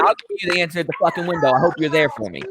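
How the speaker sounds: fast, menacing threat; mock threatening customer. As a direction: warn him to be at the window.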